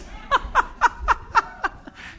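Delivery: high airy giggle; high breathy laugh. Real, not acted. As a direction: laugh at the echo joke. Laughing at own joke.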